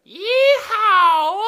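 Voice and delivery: a huge high yeehaw shout; cowboy whoop. Acted. Comma cowboy whoops as he jumps into the sentence. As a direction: whoop like a rodeo rider.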